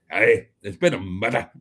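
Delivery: hammed-up Glaswegian; mock-dramatic Glaswegian accent. Shown as a demonstration. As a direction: say it like a Glaswegian announcing a crime. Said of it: "murder in a Glaswegian accent".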